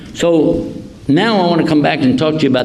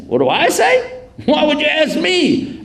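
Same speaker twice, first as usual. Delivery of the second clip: much higher, mock-innocent reply; feigned innocence. Abraham answers the crowd, mock innocent. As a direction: play dumb about it. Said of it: "Abraham plays innocent".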